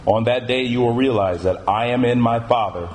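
measured, solemn Bible reading with weighted pauses; scripture reading. Acted. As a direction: read the verses out deliberately, phrase by phrase. Reads scripture aloud.

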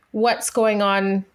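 normal talking voice

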